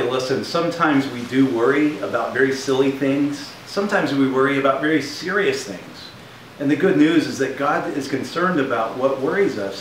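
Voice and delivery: ordinary talk, nothing marked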